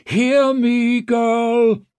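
high, loud summoning call; booming call. A narrator's reading. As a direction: boom it as if from far off. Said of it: "Cowrib's voice boomed and echoed".

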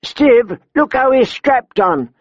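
high, pointed call to a companion; woman brigand alerting. A narrator's reading. Tall woman to Stiv about the rider, alerting. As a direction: call his attention in a high voice.

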